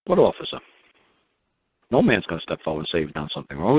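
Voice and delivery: ordinary talk, nothing marked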